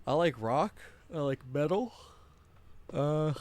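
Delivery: words stretched through a yawn; sleepy yawn dragging the words. Real, not acted. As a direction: say it mid-yawn, mouth wide open. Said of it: "sorry about the yawn".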